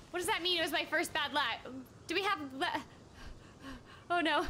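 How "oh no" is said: usual speaking voice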